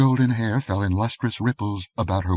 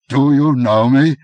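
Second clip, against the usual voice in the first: higher, much louder repeat; thunderous challenge; 7 semitones higher, 10 dB louder than usual. A narrator's reading. King revealing himself to his captors, loud challenge. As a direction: roar the question at them. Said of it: "he rumbled".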